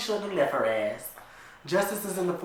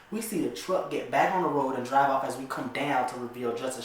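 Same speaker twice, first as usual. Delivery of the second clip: ordinary talk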